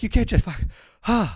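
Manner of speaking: high, loud comic disbelief; incredulous protest. Retold or imitated. Comic replaying his protest to girlfriend, incredulous. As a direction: blurt it out in mock outrage. Retells protest at quick purchase.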